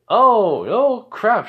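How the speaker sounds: loud, high sarcastic exclamation; mock shock. Student answers an offended rival, sarcastically. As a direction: feign surprise with big pitch swoops.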